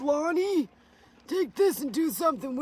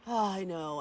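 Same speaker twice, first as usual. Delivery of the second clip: usual speaking voice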